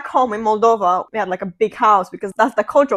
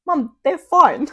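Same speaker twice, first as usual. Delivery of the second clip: slow, higher-pitched retold reply to mom; exasperated reassurance; 6 semitones higher, 3 dB louder, slower than usual. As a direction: draw it out to calm a fretting parent.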